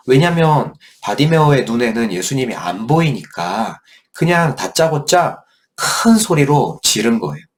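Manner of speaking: usual speaking voice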